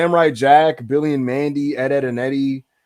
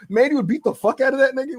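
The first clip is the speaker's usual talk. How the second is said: ordinary talk, nothing marked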